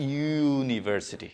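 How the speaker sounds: ইউনিভার্সিটি slowly, with a long drawn-out "yyoo"; ইউনিভার্সিটি as "yyyoo-niversity", lingering on the first sound; stretched Y sound. Shown as a demonstration. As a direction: stretch the opening glide of ইউনিভার্সিটি. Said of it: "উচ্চারণ হচ্ছে কিসের মতো".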